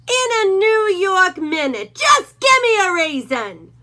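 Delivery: a bully dog snarling at Hank; menacing Doberman. A narrator's reading. Rufus voice.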